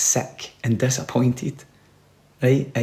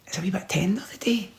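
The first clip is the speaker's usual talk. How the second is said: hushed, unvoiced confession of a hangover; whisper; 5 semitones higher, 9 dB quieter than usual. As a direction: whisper it like a guilty secret. Hungover parent confides to kids.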